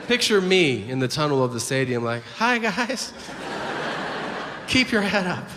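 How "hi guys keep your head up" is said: mock eager fan. Friend asking players autographs.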